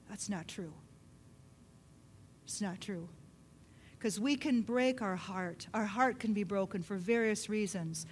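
normal talking voice

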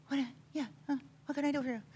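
soft, hammed-up whimpering eager servant; small, put-on anxious helper voice; frantic people-pleaser robot. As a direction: fret like an over-obliging robot. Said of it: "You're like a robot".

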